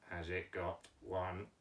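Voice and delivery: low, slow monotone puppet; puppet Mike's voice. Pretend. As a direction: answer slowly and softly on a low note. Teacher voices puppet Mike.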